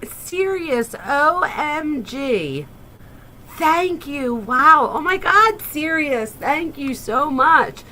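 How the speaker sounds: high, loud, overjoyed thanks; birthday streamer thrilled by donations; excited squeals. Real, not acted. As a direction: gush with real delight, near shouting.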